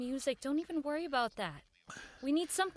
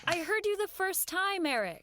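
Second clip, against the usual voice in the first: higher, louder, HEARD pushed hard; insistent repeat; 8 semitones higher, 3 dB louder than usual. Acted. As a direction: press it home, patience gone. Helen repeats, losing patience.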